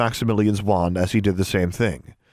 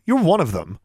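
accusing retort; 7 semitones higher than usual. A narrator's reading. Girl accuses him.

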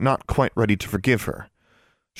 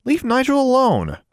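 high, sharp order to a pet; scolding; 4 dB louder than usual. A narrator's reading. Girl defending her snake from the raven, scolding. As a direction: tell the bird off, up high. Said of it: "Alexandra scolded".

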